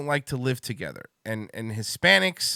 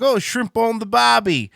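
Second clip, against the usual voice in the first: a hammed-up Aussie accent, higher and louder; mock Australian accent; 8 semitones higher, 5 dB louder than usual. Mocking Australians on a podcast, hammed-up Aussie accent. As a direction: toss off a cartoon Australian line. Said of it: "I was like".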